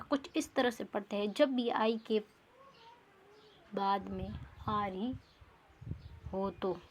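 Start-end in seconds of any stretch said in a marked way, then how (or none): none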